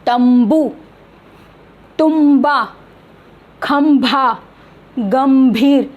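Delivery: तंबू, तुम्बा, खंभा, गंभीर with lips closed on m; separate, careful तंबू, तुम्बा, खंभा, गंभीर; pronunciation demo. Shown as a demonstration. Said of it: "उसमें म की साउंड आ रही".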